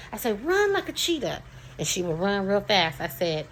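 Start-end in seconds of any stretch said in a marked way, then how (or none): 0.5-1.4 put-on high, excited call to a child; playful command. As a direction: cheer them on in a running game. Mother calling out game command.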